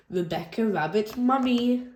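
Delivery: ordinary delivery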